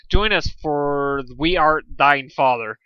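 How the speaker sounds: slow, loud, solemn; dramatic reveal. A narrator's reading. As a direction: deliver the big reveal.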